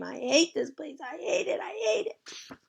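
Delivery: high, loud, crying protest; boy breaking into tears. Acted. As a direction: let it crack into crying. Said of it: "he started to cry".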